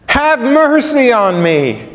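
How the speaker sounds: slow, high cry falling away; pleading. Acted. Beggar pleading with the Son of David, crying out high. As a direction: drag out the cry for mercy. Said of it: "He's pleading with him".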